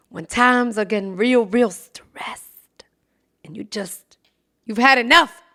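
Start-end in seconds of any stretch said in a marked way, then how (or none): 1.5-4.2 whispering. Real, not acted. Whispered aside.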